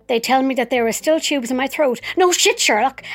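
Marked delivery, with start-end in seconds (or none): 2.2-3.0 higher, louder, scornful quip; sarcastic retort. Acted. Patient mocking the nurses' obvious remark: scornful sarcasm. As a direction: throw it back with contempt.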